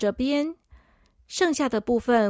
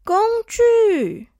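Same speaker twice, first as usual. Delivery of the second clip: put-on excited high little girl; squeaky child cry; 10 semitones higher, 6 dB louder than usual. Child character exclaims.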